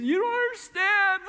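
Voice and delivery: put-on a sobbing girl answering the youth pastor. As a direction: plead through tears like a teenager. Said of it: "sobbing uncontrollably".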